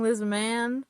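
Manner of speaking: ordinary talk, nothing marked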